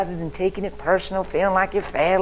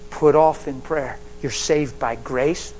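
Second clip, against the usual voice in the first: louder and slow, each word weighted; slow emphatic preaching; 4 dB louder, slower than usual. Shown as a demonstration. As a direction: drive each word home. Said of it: "Listen carefully, I'm teaching".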